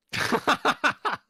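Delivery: loud laugh. Real, not acted. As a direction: burst of high laughter. Reacting to the DM.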